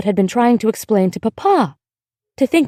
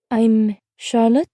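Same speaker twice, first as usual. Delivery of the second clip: put-on slow, careful girl's voice; young girl character; 2 semitones higher, slower than usual. A narrator's reading. Girl introducing herself to the woman next door, slow and careful. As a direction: introduce yourself slowly, like a careful child.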